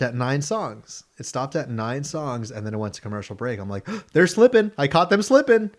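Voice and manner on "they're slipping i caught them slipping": high, loud gleeful triumph; higher and louder, caught them slipping; excited outburst. Retold or imitated. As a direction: crow it like you just won. Catching the radio slip-up.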